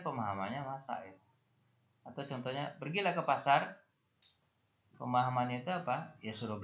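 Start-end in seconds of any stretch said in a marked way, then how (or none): 2.8-3.8 acted command. Shown as a demonstration. Teacher voicing a command example.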